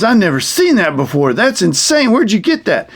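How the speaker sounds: mock amazement climbing into a high voice; amazed snake puppet. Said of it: "And the snake says".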